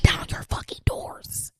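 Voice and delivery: slow, biting whisper. Real, not acted. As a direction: hiss it like a final threat. Rant punchline to businesses.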